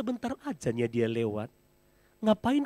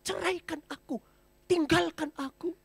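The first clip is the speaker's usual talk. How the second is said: mock angry wife; 13 semitones higher than usual. Crying woman retells what she told her husband, lightly mock angry. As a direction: lightly act the wife's angry words. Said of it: "saya ngomong sama suami saya".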